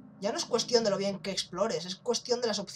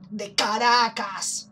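drawn-out punchline; 2 semitones higher, 8 dB louder, much slower than usual. Pretend. Remate cómico del acento.

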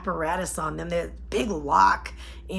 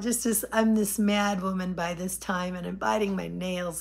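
usual speaking voice

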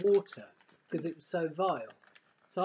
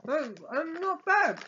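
surprised; 9 semitones higher, 4 dB louder than usual. Real, not acted. Surprised reaction to a tasty chip.

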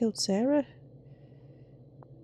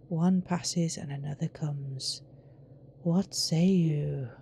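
sinister game character; 4 semitones lower, 5 dB quieter than usual. Acted. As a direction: intone it like a solemn cult leader. Reads out game character's lines.

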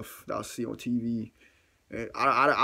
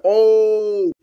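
loud, high, held-out oh; drawn-out alarmed "oh"; 8 semitones higher, 10 dB louder than usual. Retold or imitated. Man sees the bat he hit shaking, loud alarmed oh. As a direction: let the dread ring out long.

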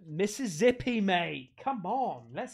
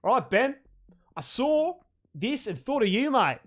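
louder, broad Australian accent with "mate"; hammed-up Aussie; 3 semitones higher, 4 dB louder than usual. Shown as a demonstration. Streamer rewrites a fan's note, hammed-up Australian accent. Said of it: "so it should be like".

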